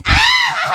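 high shrieking witch laugh; witch cackle. Pretend. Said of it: "Did you hear my witch voice?".

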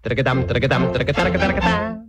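rapid tongued tattoo, rising at the end; tongue out a brisk trumpet fanfare; trumpet imitation. Storyteller introduces the young trumpet, rapid tongued imitation. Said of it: "along came a handsome young trumpet".

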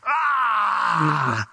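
drawn-out falling cry of "aah"; demonic outcry. Acted. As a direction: cry out like a man possessed.

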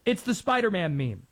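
normal talking voice

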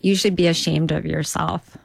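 understated shaming in a put-on local voice; imitated deadpan scolding. Pizza customer shaming the comic after her show, deadpan. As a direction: scold without raising the voice. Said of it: "She said".

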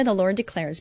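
ordinary talk

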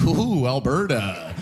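low, drawn-out mock dismay; mock outsider's unease. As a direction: draw it out low, pretending to wince at the place. Outsider looking in at Alberta.

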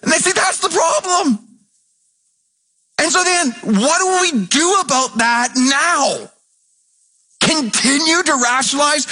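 high, shouted pulpit preaching; fiery preacher at full volume; full-force preaching. Acted. Preaching continues.

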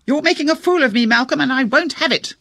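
bitterly angry wife. A narrator's reading. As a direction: hurl it at him through the ceiling. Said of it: "bitterly angry female voice".

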